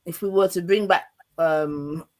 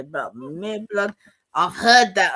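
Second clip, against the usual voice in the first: hint of Jamaican; 3 semitones higher, 5 dB louder, slower than usual. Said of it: "Prime the accent".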